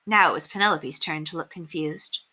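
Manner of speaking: usual speaking voice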